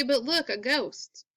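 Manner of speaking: usual speaking voice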